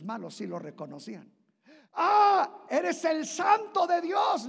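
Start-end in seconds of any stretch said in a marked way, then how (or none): 2.0-4.5 high, loud, slow demonic shout; demon's shriek. Acted. Possessed man crying out at Jesus, shrieking high and loud. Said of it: "le gritó".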